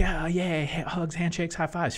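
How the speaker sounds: high, loud giddy cheer; mock giddy cheer. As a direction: play the over-eager fan. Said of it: "It's like".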